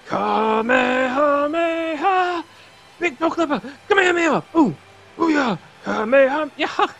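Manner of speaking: high, loud battle cry; mock Kamehameha war cry; anime attack shout. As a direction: yell it like a cartoon fighter.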